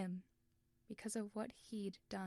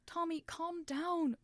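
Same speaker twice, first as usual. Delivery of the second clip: higher, louder, slow desperate plea; pleading; 8 semitones higher, 7 dB louder, slower than usual. Acted. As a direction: beg him to calm down. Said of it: "Wilbur pleaded".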